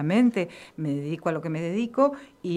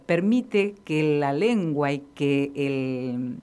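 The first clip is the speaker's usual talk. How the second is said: ordinary talk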